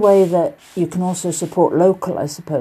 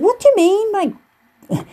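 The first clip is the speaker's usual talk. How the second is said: very high, squeaky mock disbelief; exaggerated high-pitched retort; imitated baffled woman; slower than usual. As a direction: imitate her puzzled question, pitched right up. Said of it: "in a Liverpool accent".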